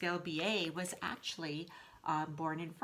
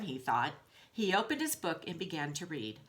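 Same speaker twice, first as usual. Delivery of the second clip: plain reading voice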